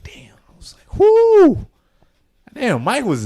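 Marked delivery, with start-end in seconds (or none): 0.0-1.0 whispered awe. Retold or imitated. Awed reaction to the song. 1.0-1.6 a high, falsetto whoo of delight; high excited whoo. Retold or imitated. Excited reaction to a song.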